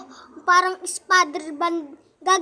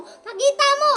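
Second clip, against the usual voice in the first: loud insisting; 5 semitones higher, 6 dB louder than usual. Real, not acted.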